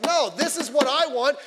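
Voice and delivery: loud, high, insistent outburst; stubborn demand. Acted. Preacher mimicking someone ruled by the flesh, stubbornly demanding. Acts out stubborn self-willed person.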